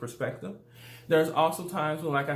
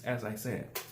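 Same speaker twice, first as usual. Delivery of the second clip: ordinary talk, nothing marked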